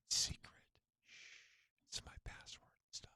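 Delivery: hushed secretive whisper; playful conspiratorial whisper. Real, not acted. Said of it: "Why am I whispering?".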